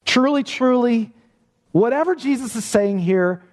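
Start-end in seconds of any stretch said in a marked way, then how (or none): 0.0-1.1 truly said high and firm both times; preacher stressing Jesus' double truly; emphatic truly. Shown as a demonstration. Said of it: "in the most emphatic, important way".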